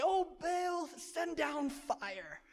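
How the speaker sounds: high, drawn-out mock plea; imitated shout to a god. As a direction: call up to the heavens in mock desperation. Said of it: "shout to their God and say".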